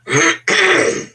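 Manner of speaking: high sharp throat clear. Real, not acted. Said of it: "it's happening again to me".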